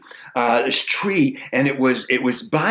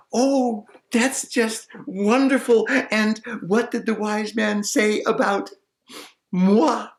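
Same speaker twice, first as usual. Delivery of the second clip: higher, coy and hopeful; coy maiden; 9 semitones higher than usual. Acted. Maiden asking what the sage said of her, coy. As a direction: fish for good news. Maiden asks about herself.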